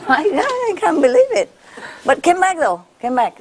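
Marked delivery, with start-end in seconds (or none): 0.1-1.5 high amazed laugh. Real, not acted. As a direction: marvel at it, laughing. Amazed at how far.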